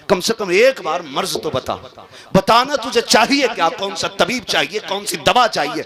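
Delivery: pleading cry. Retold or imitated. Father begs dying son to speak.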